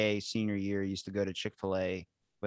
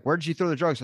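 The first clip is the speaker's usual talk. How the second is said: loud, higher demanding cop; imitating a suspicious cop, louder; stern cop; 6 semitones higher, 5 dB louder than usual. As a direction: bark the question like a cop pulling you over. He's like.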